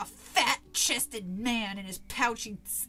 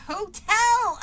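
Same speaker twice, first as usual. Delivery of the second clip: high, very loud exasperated shout; impatient cry; 7 semitones higher, 12 dB louder than usual. A narrator's reading. Girl repeats herself to the boy, impatient cry. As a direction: shout it as if he should know. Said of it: "I cried impatiently".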